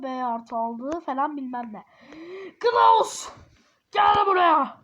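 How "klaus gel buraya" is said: loud, high summoning shout; stern mock-parent holler; calling out. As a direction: yell for the child to come. Parent summons child, acted.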